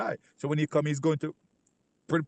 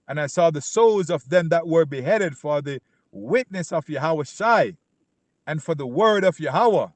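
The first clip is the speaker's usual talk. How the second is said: preaching at full force; 4 dB louder than usual. Acted. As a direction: proclaim the verse to the crowd.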